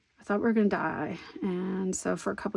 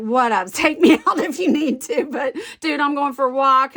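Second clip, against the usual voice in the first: higher, louder, laughing self-quote; laughing defiance; 5 semitones higher, 8 dB louder than usual. Retold or imitated. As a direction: toss it off loudly through a laugh.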